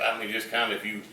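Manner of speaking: ordinary delivery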